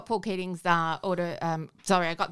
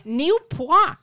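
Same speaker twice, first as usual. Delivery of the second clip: high, loud mock-French flourish; put-on continental accent, pitched up; mock foreign accent; 8 semitones higher, 6 dB louder than usual. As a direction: announce it in a showy foreign accent. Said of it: "I didn't know you spoke, uh, Italian".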